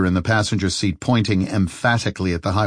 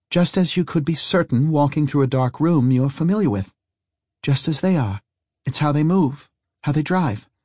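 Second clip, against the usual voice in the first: put-on lightly raised, knowing young woman; matter-of-fact higher voice; Harry's voice; 7 semitones higher than usual. Harry explains certainty.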